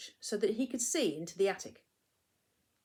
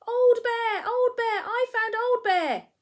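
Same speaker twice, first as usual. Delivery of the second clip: much higher and louder joyful shouting, slow on the last line; excited shout; 10 dB louder, slower than usual. Acted. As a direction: shout the discovery with delight. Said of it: "he shouted".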